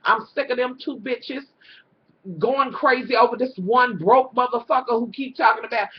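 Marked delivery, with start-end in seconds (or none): none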